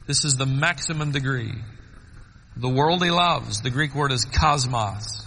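usual speaking voice

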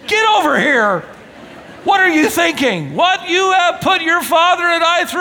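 high, loud scolding yell at a runaway son; scolding mother. Acted. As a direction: shout him down like a frantic mother. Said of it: "Mary, Mary says".

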